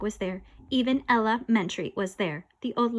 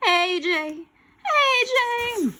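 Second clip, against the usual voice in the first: high, drawn-out loud call; eerie calling out; 12 semitones higher, 5 dB louder, much slower than usual. Acted. Scary figure calls a child at night, eerie calling out. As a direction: call the name loud and very slowly. Ghostly voice calling his name.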